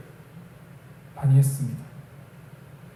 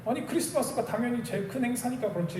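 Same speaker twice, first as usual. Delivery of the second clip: higher, softer imitation of arguing back; retold defensive retort; 7 semitones higher, 6 dB quieter than usual. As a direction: mimic a colleague justifying himself, pitched up. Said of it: "이렇게 하면서 이렇게 점점 이렇게 쌓이는 거예요". (높고 작은 목소리로 반박을 흉내)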